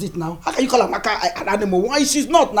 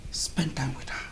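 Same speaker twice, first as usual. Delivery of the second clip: whispered advice; 4 semitones lower, 9 dB quieter than usual. Acted.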